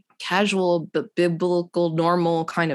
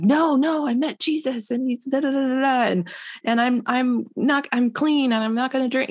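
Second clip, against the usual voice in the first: imitating him, much higher and eager; a mock-excited, higher voice, tumbling over the words; excited new convert; 9 semitones higher than usual. Friend gushing about meeting Jesus, imitated higher and eager.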